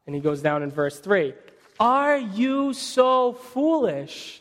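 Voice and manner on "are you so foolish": much higher, very slow disbelief; incredulous rebuke. Acted. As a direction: stretch it out in disbelief. Paul rebukes the Galatians, read aloud.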